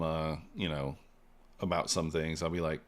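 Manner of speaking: normal talking voice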